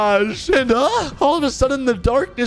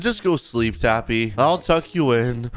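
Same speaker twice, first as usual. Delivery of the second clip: a hint of a lower, calm mother's voice; lightly voiced mom; 8 semitones lower than usual. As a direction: settle the child down, low and easy. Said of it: "She said".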